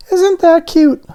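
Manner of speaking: very high, eager little donut voice. A narrator's reading. Arnie the donut on the tray, excited and high. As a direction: squeak it out with childlike excitement. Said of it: "thought Arnie".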